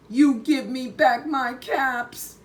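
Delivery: high, loud finger-wagging scold; scolding peddler. A narrator's reading. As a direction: tell off the cheeky monkeys. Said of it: "shaking a finger at them".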